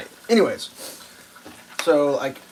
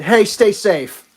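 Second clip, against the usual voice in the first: slow, loud stay safe; slow send-off; 5 semitones higher, 5 dB louder, much slower than usual. Retold or imitated. Parting words to the careless driver, slow and loud. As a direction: draw it out slowly. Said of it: "And I just said".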